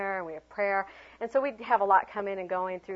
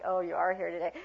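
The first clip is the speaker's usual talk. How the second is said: underwhelmed acknowledgment of an arrival; unenthusiastic welcome; 2 semitones lower than usual. Shown as a demonstration. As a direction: greet them without any enthusiasm. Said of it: "Not like".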